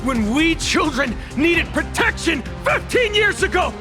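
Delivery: high, impassioned outburst; anguished accusation. Acted. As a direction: build to a high accusing cry. Acted accusing outburst about childhood.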